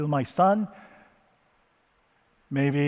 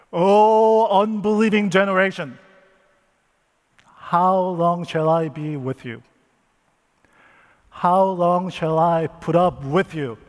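loud, higher, exasperated rebuke; upset Jesus; 3 semitones higher, 6 dB louder than usual. Acted. Jesus rebuking the unbelieving crowd, loud and exasperated. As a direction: scold the crowd in frustration. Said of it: "Jesus seemed very upset".

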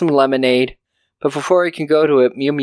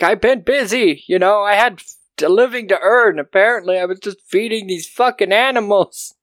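grumpy retired husband's rant; 7 semitones higher than usual. Retold or imitated. Husband rants about wife's animals.

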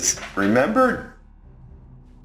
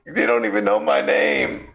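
much higher, indignant squeak; high offended squeak; 13 semitones higher than usual. Retold or imitated. Tario's offended quote.

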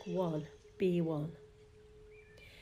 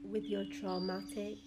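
soft fading meditation voice; guided meditation; 12 semitones higher, 7 dB quieter than usual. Acted. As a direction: guide them gently into calm. Guided meditation over music.

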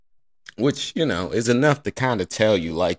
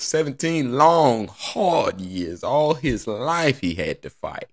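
higher, slow, preachy testimony; hammed-up sermon about a tired worker; mock preacher; 5 semitones higher than usual.

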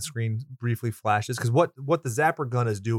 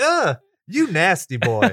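mock disgust; 5 semitones higher, 6 dB louder, slower than usual. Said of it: "I was like".

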